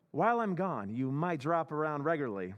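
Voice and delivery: normal talking voice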